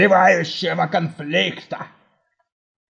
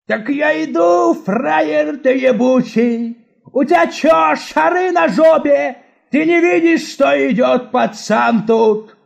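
put-on angry drunk yelling; 4 semitones higher than usual. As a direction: bark it like a drunk thug. Drunk thug threatens schoolboy.

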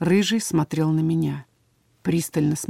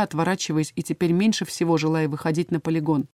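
ordinary delivery

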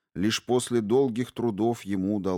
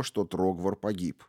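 ordinary delivery, nothing marked

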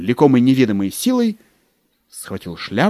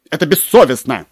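louder, indignant outburst; 4 semitones higher, 7 dB louder than usual. Acted. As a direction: scold the clerk sharply. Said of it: "сказал он сердито".

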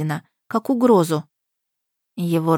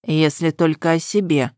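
low, level male voice; impassive; 3 semitones lower than usual. A narrator's reading. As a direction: say it without any feeling. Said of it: "бесстрастно произнёс он".

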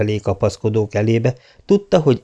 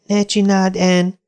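put-on high girlish plea; Diana pleading; 10 semitones higher, slower than usual. A narrator's reading. Diana begging Anne not to climb, high pleading. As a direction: hold your friend back, high and urgent. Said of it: "próbálta visszatartani Diana".

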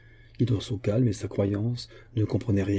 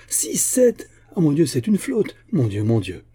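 high cry dropping to dismay; astonished alarm; 6 semitones higher, 5 dB louder than usual. A narrator's reading. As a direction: start high and loud, sink into dismay. Astonished at sighting a whole fleet.

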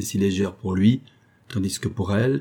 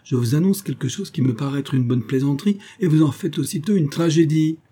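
higher-pitched, animated reproach; exasperated protest; 6 semitones higher than usual. Acted. Cousin protests at her tragic reaction. (protestation vive, plus aiguë, exaspérée)

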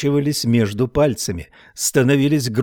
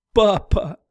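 a high, anguished cry; girl screaming as her father is shot; 9 semitones higher than usual. A narrator's reading. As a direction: cry out as he falls. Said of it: "закричала Мэв".

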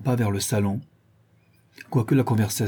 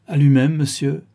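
louder, higher, lordly courtesy; drily polite; 5 semitones higher, 7 dB louder than usual. A narrator's reading. Governor answering an unknown young man, drily polite. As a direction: answer with intimidating, dry politeness. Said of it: "de ce ton sèchement poli des grands".

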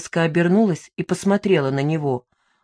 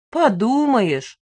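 slow, drawn-out, higher-pitched scoff; dismissive; 6 semitones higher, much slower than usual. A narrator's reading. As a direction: stretch it out with open disdain. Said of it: "его пренебрежительный тон".

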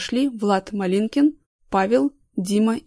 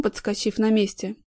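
ordinary delivery, nothing marked

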